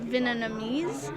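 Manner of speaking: Vietnamese said slowly, each syllable drawn out; careful pronunciation. Shown as a demonstration. Guest says Vietnamese slowly while still learning to pronounce it. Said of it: "I'm trying to learn how to pronounce it".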